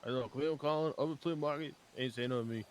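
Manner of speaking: put-on a loose Cajun accent, lightly done. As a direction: play a swamp man with a Cajun accent. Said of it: "stop doing the accent".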